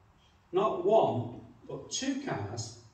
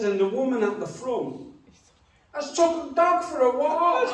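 cheeky grin; 9 semitones higher, 7 dB louder than usual. Retold or imitated. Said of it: "she said it with a grin on her face".

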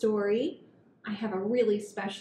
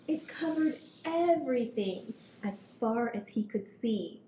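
put-on gentle read-aloud for kids; storybook reading; 3 semitones higher, 3 dB louder than usual. A narrator's reading. Reading picture book to children.

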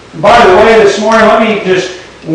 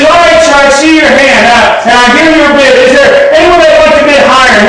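auctioneer calling bids; 7 semitones higher than usual. Retold or imitated.

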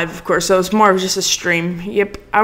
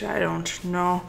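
quiet mumble; 3 semitones lower, 10 dB quieter, slower than usual. Shown as a demonstration. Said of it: "I say".

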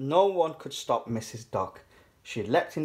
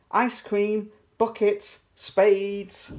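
high shopkeeper's sing-out; calling out; 8 semitones higher, 4 dB louder, much slower than usual. A narrator's reading. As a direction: hawk each item on a high note. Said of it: "called Miss Rabbit from inside".

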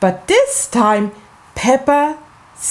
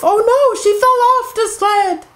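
alarmed oh no; 11 semitones higher, faster than usual. Acted. Alarm at Peppa falling.

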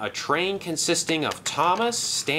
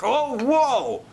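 high, alarmed yelp; startled cry; 11 semitones higher, 4 dB louder than usual. Acted. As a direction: break off in sudden alarm. Villain suddenly startled mid-boast.

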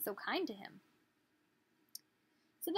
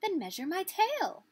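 robin; 9 semitones higher, 5 dB louder than usual. Acted. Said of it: "said the robin".

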